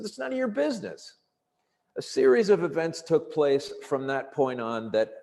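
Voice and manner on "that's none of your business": high-pitched indignant retort, retold; pissed-off refusal. Stranded traveler refusing the cop's questions, pissed-off refusal. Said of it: "now I'm getting pissed off".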